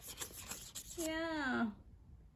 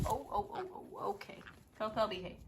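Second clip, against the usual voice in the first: usual speaking voice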